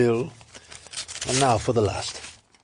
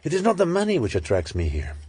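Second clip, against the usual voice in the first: ordinary delivery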